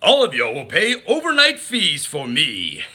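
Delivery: mock villain laugh and taunt; Pete impression. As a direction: gloat over the fees like a bad guy. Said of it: "Or maybe I could say".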